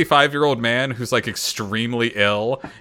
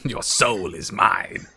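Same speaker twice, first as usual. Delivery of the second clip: slow menacing villain line; drawn-out sinister threat; Shang Tsung; 2 semitones lower, slower than usual. Retold or imitated. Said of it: "becomes the Shang Tsung of this series".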